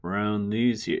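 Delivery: low, soft Southern cowboy greeting; low, hushed Southern cowboy voice; cowboy Southern accent. Retold or imitated. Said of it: "sometimes it's just a slur".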